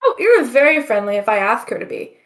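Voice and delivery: ordinary delivery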